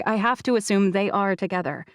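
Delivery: ordinary delivery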